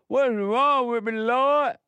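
retold anguished plea to God; pleading cry. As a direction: cry out to the Lord in mock despair. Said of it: "I asked the Father".

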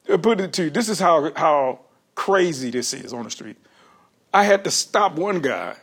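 ordinary delivery

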